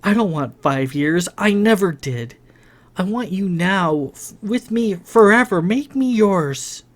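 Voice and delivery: lightly voiced Lily, yearning; Lily pleading. A narrator's reading. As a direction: beg him to make her his. Lily's passionate plea.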